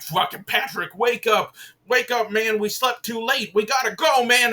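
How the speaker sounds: panicked, high, loud wake-up yelling; freaking out. Retold or imitated. Man banging on his friend's door after oversleeping, freaking out. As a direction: rouse a friend in urgent, high-pitched alarm. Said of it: "freaking out and he's, like, banging on the door".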